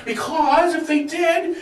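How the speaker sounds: ordinary talk, nothing marked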